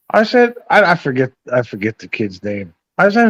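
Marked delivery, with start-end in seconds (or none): none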